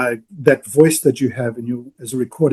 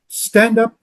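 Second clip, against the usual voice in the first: ordinary delivery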